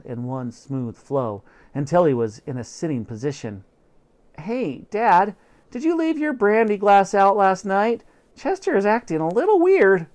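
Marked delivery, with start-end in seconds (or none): 4.4-10.1 put-on higher, boyish voice; high kid voice, puzzled; young boy. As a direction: ask Dad like a curious kid. Boy asks his father.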